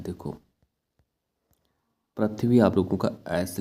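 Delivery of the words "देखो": ordinary talk, nothing marked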